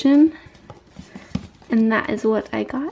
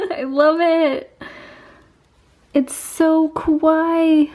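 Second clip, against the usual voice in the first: cutesy gushing; 5 semitones higher, slower than usual. Real, not acted. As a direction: coo over something adorable. Gushing over cute palette.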